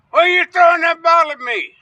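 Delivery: loud, high, accusing shout; retold angry yell. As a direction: yell it at the guy like he hit you. Angry neighbour yells about bottle.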